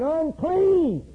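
high drawn-out call of a leper; leper's cry. Retold or imitated.

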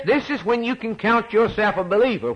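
ordinary talk

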